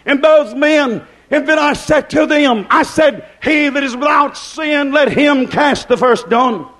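full-force preaching. Acted. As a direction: preach it high, building to shouted peaks.

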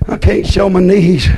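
a hint of a sighing, put-upon churchwoman; lightly mocked mournful sighing; mock moping woman. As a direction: sigh it out like a martyr in long dresses. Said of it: "You go moping around like".

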